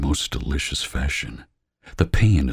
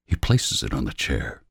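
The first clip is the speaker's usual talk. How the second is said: usual speaking voice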